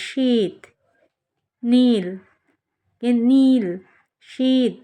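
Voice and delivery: শীত and নীল each with a long, held ঈ; long vowels. Shown as a demonstration. Teacher contrasting long vowels with quick ones, drawn out. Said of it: "এগুলো কিন্তু খুব তাড়াতাড়ি উচ্চারণ হচ্ছে".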